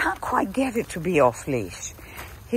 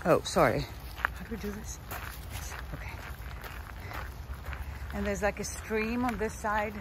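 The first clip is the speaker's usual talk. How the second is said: quiet; 11 dB quieter than usual. Real, not acted. Speaking much softer.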